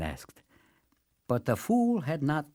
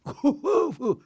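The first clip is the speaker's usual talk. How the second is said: guffaw. A narrator's reading. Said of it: "guffawing loudly".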